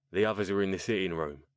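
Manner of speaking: quick Cockney remark; thick Cockney. A narrator's reading. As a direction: show guests in, speaking broad Cockney. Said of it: "in a thick Cockney accent".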